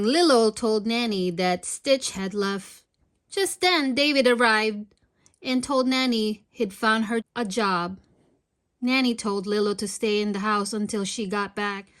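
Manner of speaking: ordinary delivery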